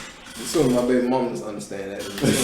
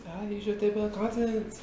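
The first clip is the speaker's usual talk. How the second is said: mock teacher voice; 8 semitones higher, 8 dB quieter than usual. Imitating the teacher.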